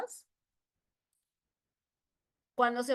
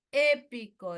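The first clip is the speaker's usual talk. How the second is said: "Épico" with a loud stressed É; acento esdrújulo; 7 dB louder than usual. Shown as a demonstration. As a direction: punch the first syllable of "Épico". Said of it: "esdrújula". ("Épico" con la É fuerte)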